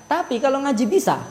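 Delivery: normal talking voice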